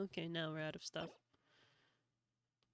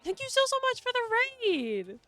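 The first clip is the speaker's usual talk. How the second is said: high, loud excited thanks; 11 semitones higher, 6 dB louder than usual. Real, not acted. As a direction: gush with gratitude.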